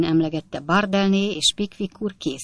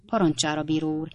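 usual speaking voice

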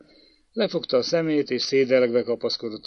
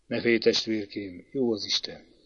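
soft, lower comforting voice; tender reassurance; 3 semitones lower, 6 dB quieter than usual. A narrator's reading. Older brother comforting the little one, soft and tender. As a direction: soothe a frightened little brother gently.

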